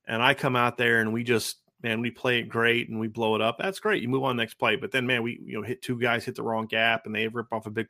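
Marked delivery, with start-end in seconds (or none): none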